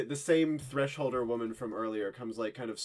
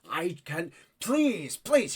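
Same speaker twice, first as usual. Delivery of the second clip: high, louder desperate please; pleading soldier; 5 semitones higher, 4 dB louder, much slower than usual. Acted. Soldier begging the hysterical woman, high and pleading. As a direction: beg her to calm down. Soldier begs Liv to calm.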